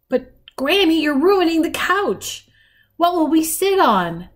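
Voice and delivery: put-on high-pitched girl's objection; distressed protest. A narrator's reading. Granddaughter to Granny ruining the couch, distressed protest. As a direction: plead with her to stop.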